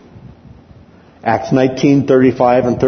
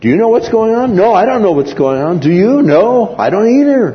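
higher, puzzled back-and-forth voices; puzzled crowd chatter; 10 semitones higher, 3 dB louder than usual. Acted. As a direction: rioters who don't know why they're there. Bewildered crowd members talk.

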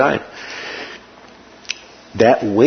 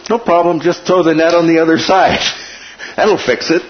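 breezy stranger; 7 semitones higher than usual. Retold or imitated.

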